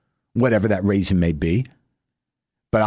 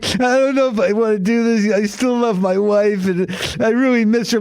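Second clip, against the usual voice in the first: higher, whiny, near-tearful; mock crying; 10 semitones higher than usual. Divorced man missing his wife, mock weepy. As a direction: whimper it like a heartbroken husband. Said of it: "You could be crying".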